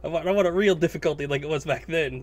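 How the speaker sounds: ordinary talk, nothing marked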